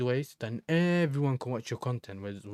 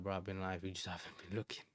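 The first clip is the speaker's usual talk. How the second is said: soft chuckling "you just haven't been looking"; laughing retort; 4 semitones lower, 11 dB quieter, faster than usual. Retold or imitated. Streamer answering fans who missed streams, soft laughing. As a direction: laugh through the comeback. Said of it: "And it's like".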